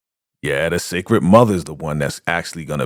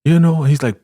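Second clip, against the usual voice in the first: ordinary talk, nothing marked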